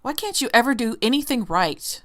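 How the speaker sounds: mock the critic who scolds you; lightly voiced scolding inner critic; harsh critic.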